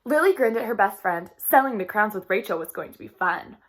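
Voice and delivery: lively read-aloud storytelling voice; reading to children. A narrator's reading. Teacher reading a storybook to her class, lively. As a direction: read the chapter brightly to young listeners.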